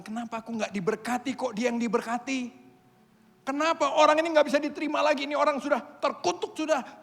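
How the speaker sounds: high, loud indignant protest; mock self-righteous griping; complaining to God. Pastors protesting to God like the older brother, loud and indignant. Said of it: "Protes sama Tuhan".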